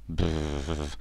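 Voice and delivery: long lip-trilled shiver; freezing duckling's brrr; shivering brr. A narrator's reading. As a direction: shudder with cold through the lips. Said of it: "he said with both wings held close".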